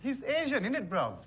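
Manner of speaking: high exasperated outburst, falling at the end. Acted. Deliveryman finally answers the host's last "Why?", exasperated. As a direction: burst out with the obvious.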